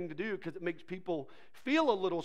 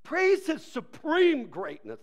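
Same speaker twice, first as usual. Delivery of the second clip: slow, high, loud proclamation; ringing psalm reading; exclaimed scripture; 8 semitones higher, 6 dB louder, slower than usual. Acted. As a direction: declare the verse with big emphasis.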